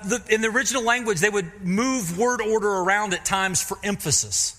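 normal talking voice

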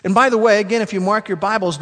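normal talking voice

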